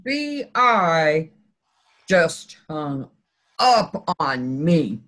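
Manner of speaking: stunned disbelief. Retold or imitated. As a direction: drop low and spell it out word by word, dumbfounded. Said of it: "and I'm like".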